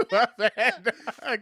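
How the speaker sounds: high, laughing apology; laughing through the apology. Real, not acted.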